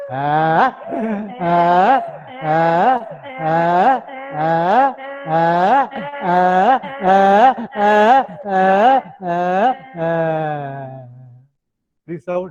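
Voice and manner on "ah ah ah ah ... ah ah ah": donkey bray. Shown as a demonstration. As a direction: bray like a donkey, pumping each ah. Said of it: "How does a donkey sound?".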